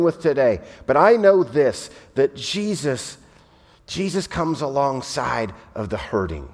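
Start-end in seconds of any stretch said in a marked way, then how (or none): 2.2-6.5 soft, slower, close comfort; tender reassurance. Real, not acted. Preacher reassuring hurting listeners, soft and tender.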